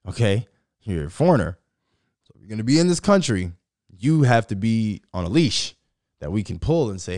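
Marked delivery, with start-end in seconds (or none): 0.1-5.7 a lightly voiced stern official, louder; mock government official. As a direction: lay down the law to a foreigner, loud and half-mocking. Voicing the government's stance on immigrants.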